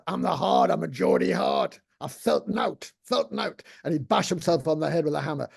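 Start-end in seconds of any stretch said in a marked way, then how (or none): 0.1-3.6 imitating a Geordie hard-man character. Comedian recalls a performance poet's character, imitated Geordie hard man. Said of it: "invented this character called the Hard".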